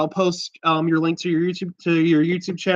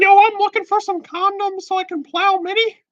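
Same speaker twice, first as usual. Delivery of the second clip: very high squeaky cartoon mouse voice; Mickey Mouse voice. Prank-calling Walmart as a high cartoon mouse. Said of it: "It's me, Mickey Mouse".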